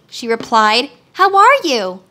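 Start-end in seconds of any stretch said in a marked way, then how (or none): 1.1-2.0 put-on high, louder girlish question; little girl voice. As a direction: ask it brightly like a young girl. Dorothy greets the Lion.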